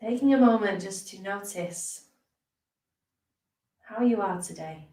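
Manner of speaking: unhurried meditation teacher, leaving space between phrases; guided meditation. Acted. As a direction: ease listeners into rest, phrase by phrase. Leads a guided body scan.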